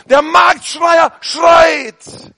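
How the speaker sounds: slow, high, drawn-out shout; market crier shout. Shown as a demonstration. Preacher imitating a market crier, slow loud shout. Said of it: "Schreien, ausrufen".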